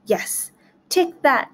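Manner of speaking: high, slow gloating "take that"; triumphant cry. A narrator's reading. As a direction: savour the victory. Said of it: "cried the gingerbread man".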